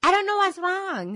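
high-pitched mock bafflement; acted helpless complaint; bewildered sufferer. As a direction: plead confusion, pitched high. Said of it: "You're like".

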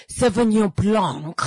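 Sauvignon Blanc pronounced the French way; put-on French accent for the wine name. Diner ordering a bottle, imitating a French accent. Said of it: "we pronounce their food in their accent".

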